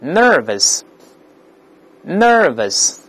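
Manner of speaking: nervous said very slowly, r only in the first syllable; American model. Shown as a demonstration. Teacher leads students reading, slow nervous with first-syllable r. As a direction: stretch nervous out, curl ner but not the ending. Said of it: "跟老师读一下".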